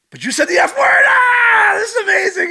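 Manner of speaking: very high, loud, hammed-up squeal; over-the-top screaming astonishment; amazed shriek. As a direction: shriek it in mock delight. Acting out amazement at mom swearing.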